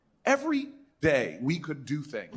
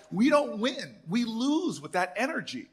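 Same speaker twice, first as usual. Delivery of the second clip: insistent; 8 semitones higher than usual. Retold or imitated. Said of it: "I'm like".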